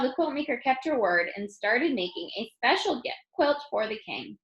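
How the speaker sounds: plain reading voice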